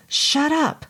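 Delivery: sharp, high barked order. Acted. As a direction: bark it angrily. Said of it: "barked George Thousand Names".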